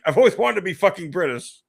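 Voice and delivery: mock-gleeful impression of Trump; mock Trump gushing. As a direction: ham up his delight. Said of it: "he's like".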